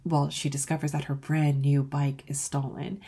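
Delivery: ordinary delivery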